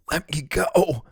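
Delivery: strangled, high plea; choking. A narrator's reading. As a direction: force it out while being choked. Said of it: "she choked".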